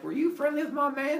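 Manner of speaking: high-pitched mock indignation; sassy confrontation. Hera confronting Leto about her man, indignant.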